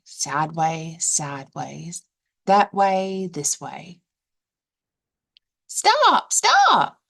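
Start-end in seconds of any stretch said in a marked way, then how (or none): 5.7-7.0 high, loud squawking cry; a flustered hen shrieking stop. Acted. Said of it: "cried the first hen".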